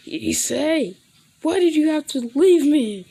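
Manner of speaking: high, loud mock wail; crying out. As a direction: wail it out, play-acting grief. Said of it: "everybody hears him crying".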